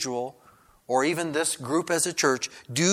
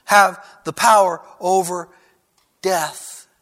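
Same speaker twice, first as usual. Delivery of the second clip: loud, raised, measured proclamation; forceful pulpit declaration; emphatic declaration; 4 semitones higher, 7 dB louder than usual. Acted. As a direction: proclaim it phrase by phrase with pauses. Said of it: "that Jesus is making here".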